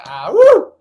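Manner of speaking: high, loud whoop of release; excited whoop. Real, not acted. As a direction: shout out the rush. Release after breath round.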